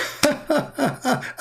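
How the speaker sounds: very high giddy cackle of delight; mock delighted laugh. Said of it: "and Allah start like".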